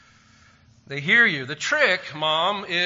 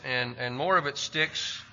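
ordinary delivery

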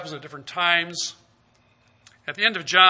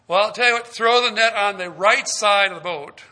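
louder, higher call with advice; 3 semitones higher, 7 dB louder than usual. Acted. Jesus calling from shore to the fishermen, raised voice. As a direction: call the tip out across the water.

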